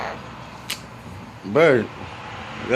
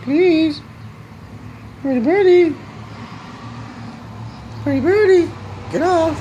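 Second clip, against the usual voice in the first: very high sweet talk to a bird; 4 dB louder, slower than usual. Real, not acted. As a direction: coo it up high like to a pet. Baby talk to the parrot.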